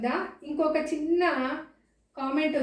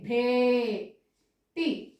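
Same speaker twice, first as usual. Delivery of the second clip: Beti as long భే, pause, టీ; very slow, broken Beti; syllable by syllable; much slower than usual. Shown as a demonstration. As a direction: hold భే, then add టీ. Teacher splits word into syllables.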